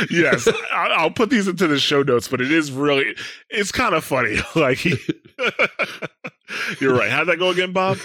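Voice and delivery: loud, laughing through words; laughing while talking. Real, not acted.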